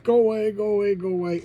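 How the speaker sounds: hammed-up panicked shooing, easing at the end; mock frantic yell. Frightened man repels the ghost.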